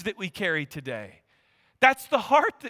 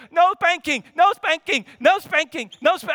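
put-on higher, louder child's plea, repeated fast; pleading little boy; 7 semitones higher, 5 dB louder than usual. Young son begging his dad for no spanking, high and pleading. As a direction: beg like a kid fleeing his dad.

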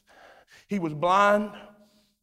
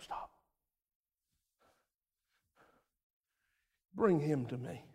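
hushed telling; 5 semitones lower, 11 dB quieter than usual. A narrator's reading. Quiet narration of Jesus arriving.

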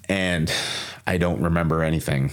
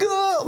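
groggy groan. Retold or imitated. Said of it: "Again, I'm like".

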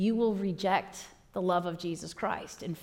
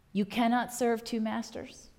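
ordinary talk

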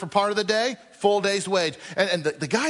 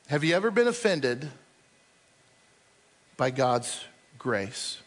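ordinary talk, nothing marked

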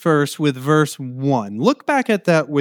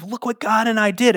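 higher, proudly delighted; good pride; 8 semitones higher than usual. Acted. Imagined Eve proud of her newborn, good pride. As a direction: show off the shared achievement. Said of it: "good pride of".

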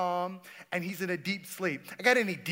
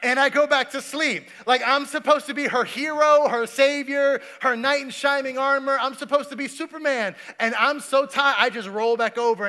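louder, higher preaching build; full preaching; 7 semitones higher, 9 dB louder than usual. Acted. Pastor confessing to the congregation he slept through it, preaching. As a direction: drive the confession home.

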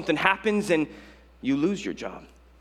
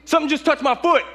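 high, loud shriek of alarm; panicked yell; 7 dB louder than usual. Retold or imitated. Swimmer brushed by something in the ocean, panicked yell. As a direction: yelp in exaggerated panic, much higher and louder. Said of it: "and I yell".

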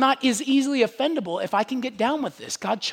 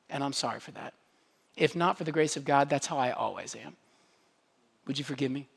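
soft, sincere confession, much softer; humble apology; 5 semitones lower, 5 dB quieter, faster than usual. Shown as a demonstration. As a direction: quietly ask forgiveness, meaning it.

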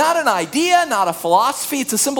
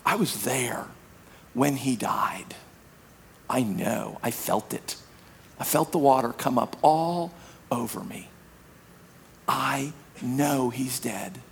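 hushed solemn recollection; 8 semitones lower, 8 dB quieter than usual. Acted. Solemn baptism account, very soft.

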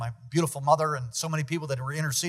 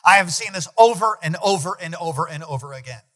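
raised, loud sermon delivery; fiery preaching; 6 semitones higher, 6 dB louder than usual. Real, not acted. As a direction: preach it with full force. Preaching at full force.

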